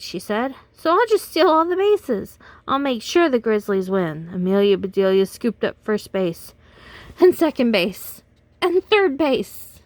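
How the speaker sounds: put-on lively read-aloud for kids; animated storyteller voice for children; storybook reading. A narrator's reading. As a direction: read it like bedtime story time. Reads picture book to children.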